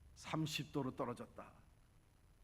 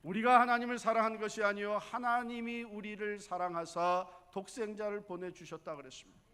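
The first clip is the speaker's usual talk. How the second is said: loud, rising sermon cry over the congregation; preaching at full force; 9 semitones higher, 14 dB louder than usual. Acted. Pastor preaching loudly to the congregation, fervent. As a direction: preach it out to the pews.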